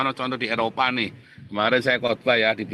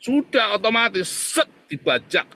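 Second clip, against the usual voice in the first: preaching burst; 9 semitones higher, 5 dB louder than usual. Acted. Preaching burst, then slowed.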